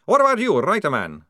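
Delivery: high, loud snarled challenge; explosive accusing demand, dropping at the end; snarling. A narrator's reading. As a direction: turn on him with a loud snarl. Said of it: "snarled Maledict".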